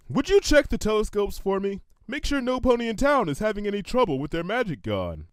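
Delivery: casual, slightly scheming request; lightly voiced unicorn asking her friend to check; Lyra's voice. A narrator's reading. Lyra asks Bon Bon.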